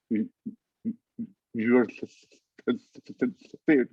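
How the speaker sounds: stuttering. Real, not acted. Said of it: "stutter with my patients".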